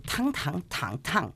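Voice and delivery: "tang" four times, level, rising, dipping, falling; four Chinese tones. Shown as a demonstration. Student sums up the diet rules, "tang" in four tones. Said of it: "cuatro tonos".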